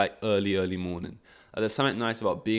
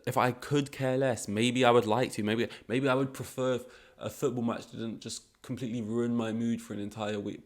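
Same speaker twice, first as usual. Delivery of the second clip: ordinary talk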